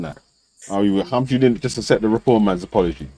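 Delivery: ordinary talk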